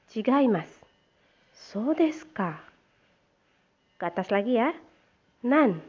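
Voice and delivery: loud teacher phrase drill. Shown as a demonstration. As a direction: call each phrase out clearly so the class can repeat it. Teacher models Japanese phrases aloud.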